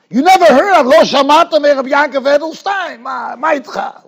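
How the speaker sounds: loud, incredulous outburst at the listener; offended cry of disbelief; indignant shout. Retold or imitated. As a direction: shout it like he can't believe they haven't heard. Indignant man retold in story.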